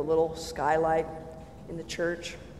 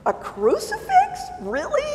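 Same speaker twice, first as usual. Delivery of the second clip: incredulous. Retold or imitated. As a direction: sound scandalised by what you see. Mocking churches she doubted.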